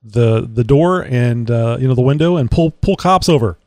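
ordinary talk

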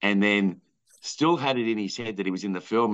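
ordinary delivery

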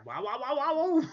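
cartoon plumber yelps; Luigi wah. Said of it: "That's all I can think of".

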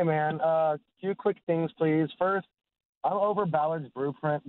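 normal talking voice

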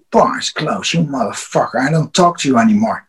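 low, soft imitation of a sulking drunk; mock sulky self-talk; drunk talking to himself. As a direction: imitate drunken grumbling, dropped in pitch and volume. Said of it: "and talked to himself".

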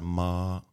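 "ma" held level; "ma" in Mandarin first tone, no rise or fall; flat tone. Shown as a demonstration. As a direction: hold "ma" on one steady, flat pitch. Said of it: "flat sound".

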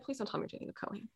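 soft, fast, lightly voiced rabbi; a hint of a dismayed rabbi; rabbi's plea. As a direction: mutter it like a worried rabbi. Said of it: "he's like".

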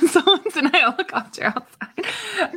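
laughing through the words; giggling as she says it. Real, not acted. Amused at helicopter news.

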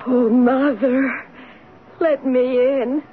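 very slow, drawn-out plea; pleading voice. Acted. Girl relaying the ghostly voice to her father, pleading. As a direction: plead like a lost voice begging at a door. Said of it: "the pleading voice".

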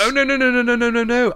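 rapid high-pitched string of no's; frantic denial. Acted. Frantic denial he'd destroy Christmas.

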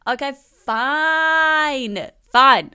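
grudging concession. Real, not acted. Conceding loudly.

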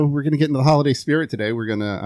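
ordinary delivery

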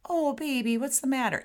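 high, cooing pity; over-sympathetic baby talk; mock babying. Shown as a demonstration. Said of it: "and not".

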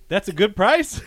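a playful imitation of his friend; mocking quote. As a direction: tease him by parroting it back. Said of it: "'cause you were like".

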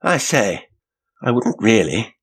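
high-pitched urging. A narrator's reading. Loquacious passenger to Janet as she leaves, urging her. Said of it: "he urged".

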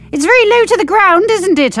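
high, loud strained voice; strained grunt. A narrator's reading. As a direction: squeeze it out mid-squat. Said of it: "I grunt".

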